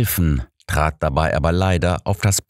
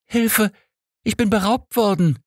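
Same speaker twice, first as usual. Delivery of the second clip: much higher shrill cry for help. A narrator's reading. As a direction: shriek out she's been robbed. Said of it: "als sie plötzlich schrill rief".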